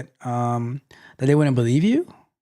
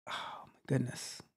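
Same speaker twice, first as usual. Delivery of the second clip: whispered aside; 17 dB quieter than usual. Real, not acted. Aside, dismayed by situation.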